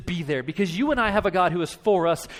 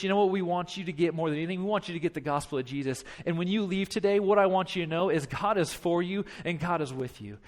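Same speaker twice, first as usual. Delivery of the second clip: normal talking voice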